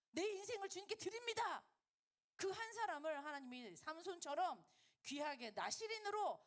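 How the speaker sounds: loud, high, impassioned proclamation; fervent preaching. Acted. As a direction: cry out the prayer, driving each phrase.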